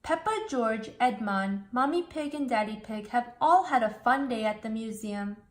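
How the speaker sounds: ordinary delivery, nothing marked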